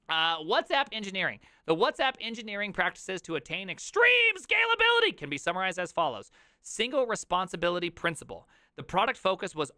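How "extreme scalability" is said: high, loud mock-epic burst; hammed-up hype. Streamer reads out WhatsApp's practices, suddenly mock-epic. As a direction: blare it out like a hype slogan. Hammed-up reading of article's phrase.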